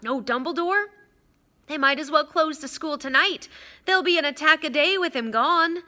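alarmed youngster, rising in disbelief; worried schoolboy, dismayed. Acted. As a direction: fret that disaster is coming. Frightened boy's lines in narration.

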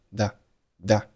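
very slow "the", t held silent before f; "that" with the final t swallowed; unreleased t in that. Shown as a demonstration. Teacher slowly showing "that" with a silent t. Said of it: "读成了the，the".

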